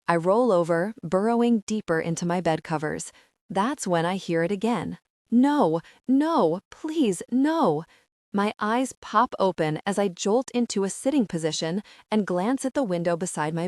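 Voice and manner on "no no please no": high, loud desperate pleading; a woman's frightened scream of no. A narrator's reading. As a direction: beg in terror, voice climbing. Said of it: "A scream wakes me".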